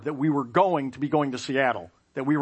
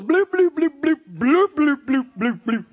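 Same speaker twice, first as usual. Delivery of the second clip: high, loud bloop noises that fade out with a laugh; dripping sound effect; 12 semitones higher, 8 dB louder than usual. Retold or imitated. Imitating blue cheese dressing splattering onto his shirt, loud and high. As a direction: plop it out like dressing hitting a shirt.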